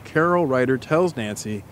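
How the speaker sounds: lightly voiced guided-scene narration, calm and even; story narration. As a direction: paint the scene for her, unhurried. Narrates immersive scene to co-host.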